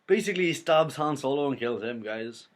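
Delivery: really worked up, nearly shouting it; shocked outburst. Real, not acted.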